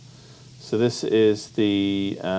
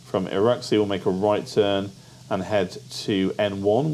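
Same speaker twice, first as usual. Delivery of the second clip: ordinary delivery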